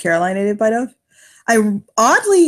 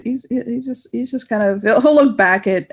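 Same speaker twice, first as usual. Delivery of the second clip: ordinary talk, nothing marked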